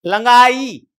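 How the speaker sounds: लंगाई with a velar ng after the first vowel; loud teaching demo of ng in लंगाई; nasal ङ sound. Shown as a demonstration. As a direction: ring the ng in लंगाई. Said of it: "इसका उच्चारण".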